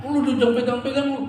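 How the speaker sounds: high, fast scolding that slows at the end; imitated trader snapping at a buyer; mock snapping seller. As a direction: bark at a customer touching the goods, half in fun.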